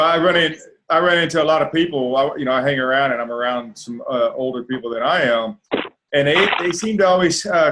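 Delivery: ordinary talk, nothing marked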